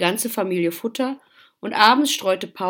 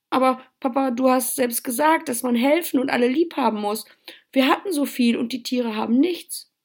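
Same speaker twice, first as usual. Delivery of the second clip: higher, upset pleading; pleading, near tears; 6 semitones higher, 4 dB louder than usual. A narrator's reading. Child appealing to father for support, upset and pleading. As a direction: plead your case with a touch of tears. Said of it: "stiegen die Tränen in die Augen".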